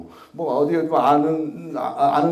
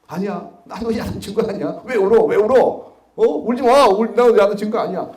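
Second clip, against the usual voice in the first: put-on raised, higher voice telling the boy not to cry; loud reassurance; 6 semitones higher, 8 dB louder than usual. Prosecutor reassuring a crying boy, loud and high. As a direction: call out to him, don't cry, I'm not scolding. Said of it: "물었습니다".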